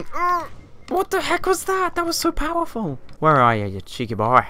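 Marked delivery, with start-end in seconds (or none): 0.0-3.0 much higher, amazed at the hit; shocked squeak at a huge hit; stunned. Real, not acted. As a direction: gasp it out in disbelief. Stunned by enemy weapon.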